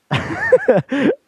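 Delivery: sudden high laugh; burst of laughter. Real, not acted. Laughs at rigging joke.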